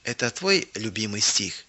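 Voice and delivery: softly asked, a little subdued; soft, tentative narrated question; quiet question. A narrator's reading. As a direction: ask it quietly. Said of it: "тихо спросила".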